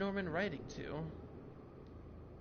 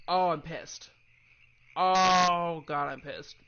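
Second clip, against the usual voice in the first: pissed off; 2 semitones higher, 12 dB louder, much slower than usual. Real, not acted. Annoyed at missed content.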